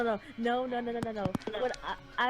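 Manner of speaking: ordinary delivery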